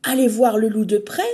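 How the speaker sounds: loud, fast enthusiasm; excited cry. Acted. Emma cries out, thrilled by the idea, loud and fast. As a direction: burst out with delight. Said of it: "s'écrie Emma".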